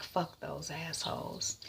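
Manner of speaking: dazed, hushed "Fuck those assholes."; quiet echo. Acted. Laura echoes the cabbie.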